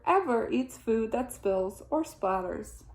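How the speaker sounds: ordinary delivery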